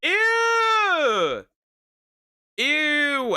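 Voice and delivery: high, loud grossed-out squeal; disgusted shriek. Real, not acted. Reacting to animal brains.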